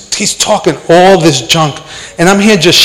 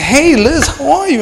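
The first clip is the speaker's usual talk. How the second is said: higher, softer imitated greeting; retold friendly greeting; 8 semitones higher, 4 dB quieter than usual. Greeting a girl from school in the store, retold high and soft. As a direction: lightly act out greeting an old schoolmate. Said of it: "And I said, I said".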